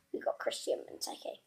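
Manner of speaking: quick hushed whisper to himself; whispered aside. Real, not acted. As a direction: breathe it out fast. Whispered aside after reveal.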